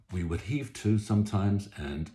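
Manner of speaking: ordinary talk, nothing marked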